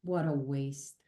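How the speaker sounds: a soft, low deathbed whisper of regret; dying mother's words. Retold or imitated. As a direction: let it fall away like a final breath. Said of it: "she goes".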